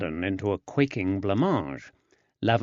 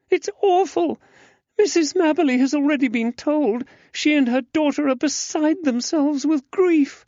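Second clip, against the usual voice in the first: put-on much higher, louder young woman in dismay; distressed young lady; 5 dB louder than usual. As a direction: cry out like a shocked young lady. Susanna upset over the death.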